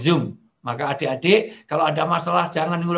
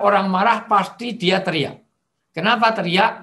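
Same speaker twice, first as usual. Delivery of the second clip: ordinary talk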